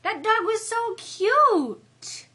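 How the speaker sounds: high, loud, drawn-out coo over a cute dog; stretched, swooning "so cute"; gushing. Real, not acted. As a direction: squeal it out slowly, melting over the dog.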